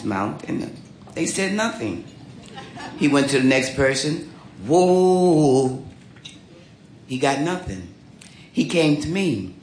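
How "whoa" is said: a drawn-out showman's whoa into the mic; singer's call. Retold or imitated. Lead singer [name] calling out to the audience, a drawn-out showy whoa. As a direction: call out to the crowd like a stage singer.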